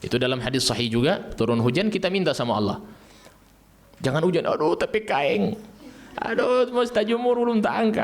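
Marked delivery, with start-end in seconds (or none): 4.0-8.0 high, whiny mock fretting over clothes in the rain; hammed-up worried woman. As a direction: play a flustered woman fussing about her clothes.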